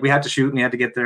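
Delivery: normal talking voice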